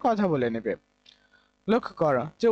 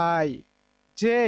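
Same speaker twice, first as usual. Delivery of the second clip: ordinary delivery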